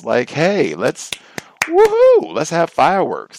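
hammed-up, high, loud pep talk building to a whoop; mock excitement. Booster hounding coworkers about the King holiday, exaggerated excitement. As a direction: imitate over-eager holiday hype. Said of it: "hounding to get people excited".

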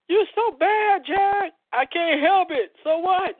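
high, loud mock back-and-forth; put-on sassy character. Host hamming up a "so bad" exchange, high put-on voice.

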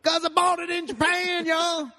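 high, loud slow twang; drawn-out Southern pop star; [name] voice. Pretend. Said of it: "[name]".

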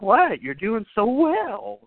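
high, slow, sing-song taunting praise; a hammed-up creepy cheerfulness; mock praise. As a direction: coo the compliment like a toying killer. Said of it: "he's like".

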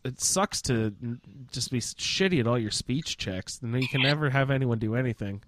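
ordinary talk, nothing marked

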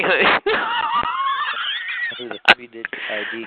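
long, very high real laugh. Real, not acted. Host amused by the chat liners' reaction, high cackle. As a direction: let a shrieking cackle rip.

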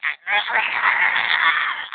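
mock puppy growl, rising at the end; imitated snarly puppy growl. Telling the stream how the puppy growls, mock growl. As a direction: growl like a tiny dog tugging a toy. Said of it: "this horrible snarly growl comes out of her".

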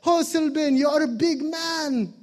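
put-on high, fond grandma voice, lightly accented; doting Filipino grandma. Grandma admiring her tall grandson, high and fond. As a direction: marvel at your big grandson. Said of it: "My grandma used to be like".

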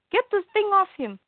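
high, pressing urgency; mock panicked instruction to the son; urgent plea. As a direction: hurry him along anxiously.

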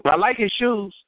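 high, lightly voiced mother, airy and unbothered; hint of a clueless mother; mama voice. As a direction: toss it off like mama missing the point entirely. Mama voiced in retold story.

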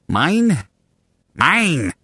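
high, isolated squawk of one word; cartoonish high call; movie creature imitation. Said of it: "It's Nemo".

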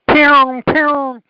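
very high, loud pew pew; pew pew shooting noise. Retold or imitated. Telling the Puntos ad, in a high loud mock pew pew. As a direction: fire off candy like a toy gun.

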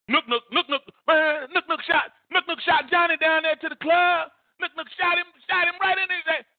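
high, far-off offhand gossiping voice; imitating street gossip. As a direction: rattle off the news like a bystander.

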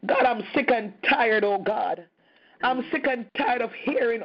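ordinary talk

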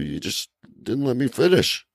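normal talking voice

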